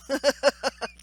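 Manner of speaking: quick self-conscious chuckle; embarrassed laugh. Real, not acted. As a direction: laugh off the tears. Laughs about not crying.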